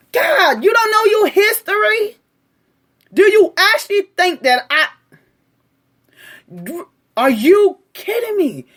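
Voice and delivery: high, loud, fed-up outburst; exasperated disbelief. Real, not acted. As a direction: let the frustration burst out. Exasperated at commenter's ignorance.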